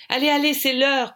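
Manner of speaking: high, loud rallying call; shouted hurry-up across the room; shouting. A narrator's reading. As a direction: call everyone to get moving. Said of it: "crie Audrey".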